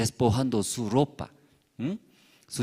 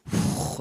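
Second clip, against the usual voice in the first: blown wind gust. Acted. Cloud blows with full force.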